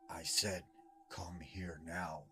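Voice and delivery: menacing creature whisper, slow and breathless; changed creature voice. Retold or imitated. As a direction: lure him in with a hushed, slow threat. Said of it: "the voice had changed".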